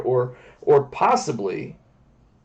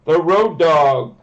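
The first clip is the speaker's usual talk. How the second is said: booming big top announcer, higher and louder on the big lines; ringmaster; 4 semitones higher, 5 dB louder than usual. Acted. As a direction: ringmaster calling the crowd. Circus-style show introduction.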